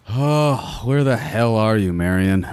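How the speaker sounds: high, exasperated mutter of impatience; impatient. Acted. Killer waiting in the alley for Marion, impatient.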